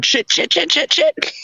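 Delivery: high, frantic repeated swearing; panicked cursing. Retold or imitated. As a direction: panic like you're about to soil yourself.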